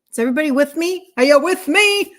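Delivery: rising to a loud, high rally call; fired up. Real, not acted. Host firing up her live viewers, loud and high. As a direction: pump up the crowd. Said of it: "I get fired up".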